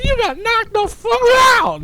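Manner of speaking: falsetto shout building to the last word; high mock-gloating holler; imitated taunting yell. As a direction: screech the taunt like a hype man. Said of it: "like that, like".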